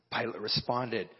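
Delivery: ordinary talk, nothing marked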